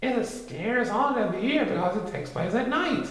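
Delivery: put-on high boyish Christopher Robin voice; young Christopher Robin explaining. A narrator's reading.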